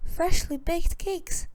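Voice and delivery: high, slow gasp of delight; a child spotting freshly baked cakes; excited cry. A narrator's reading. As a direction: marvel at the cake house, drawn out. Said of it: "cried Gretel".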